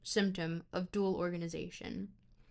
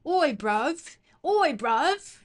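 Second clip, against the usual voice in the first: loud, high mock British street call; exaggerated British geezer accent; 6 semitones higher, 8 dB louder, much slower than usual. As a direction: holler it like a hammed-up Brit. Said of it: "speak in a British accent".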